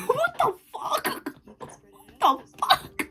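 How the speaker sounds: high, slow, half-crying laugh; mortified high-pitched laughing wail; laughing disbelief. Acted. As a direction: squeak it out through mock sobs. Reacting to mom's embarrassing caption.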